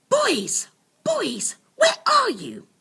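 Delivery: high, loud call from outside. A narrator's reading. Werner's mum calls loudly from outside the cave. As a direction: shout for the lost boys.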